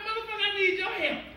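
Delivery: mock yell.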